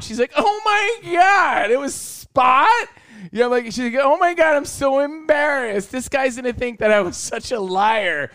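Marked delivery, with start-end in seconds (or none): 0.4-2.9 high, drawn-out mock disbelief; exaggerated incredulous squeal, words dragged out; mock shocked. Acts out Jordan's shocked reaction. 4.0-8.3 high-pitched imitation of embarrassed friend; high, overdramatic mock embarrassment; mock mortified. Acts out Jordan's embarrassed reaction.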